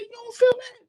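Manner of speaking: very high, astonished retold question; incredulous. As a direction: act amazed that nobody else noticed. Amazed, pressing the girl.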